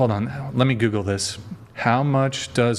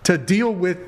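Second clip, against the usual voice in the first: incredulous tallying; 7 semitones higher than usual. Real, not acted. Host challenging caller on her high standards, incredulous.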